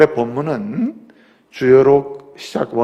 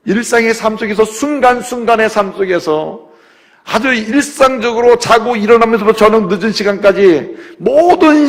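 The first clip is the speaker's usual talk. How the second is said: high, loud preaching climax; fervent preaching; 9 semitones higher, 7 dB louder than usual. Acted. Pastor exhorting the congregation on great faith, fervent preaching. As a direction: preach it with rising fire.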